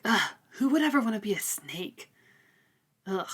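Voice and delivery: disgusted whisper. Retold or imitated. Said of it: "But she's like".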